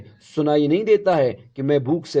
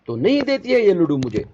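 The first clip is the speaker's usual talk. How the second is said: higher, louder angry complaint; angry outburst; 7 semitones higher, 4 dB louder than usual. Acted. Woodcutter angry at his wife over the laddoo, higher and louder. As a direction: snap it out in anger. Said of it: "بالکل غصے میں ہوتا ہے".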